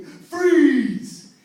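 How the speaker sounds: high, very loud scream of Freeze; a rifleman screaming an order; armed guard screaming Freeze; screamed command. A narrator's reading.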